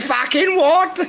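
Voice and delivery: very high, loud squawk; shrill disbelieving granny squawk; old British grandmother. Retold or imitated. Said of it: "He said".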